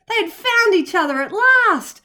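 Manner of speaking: plain reading voice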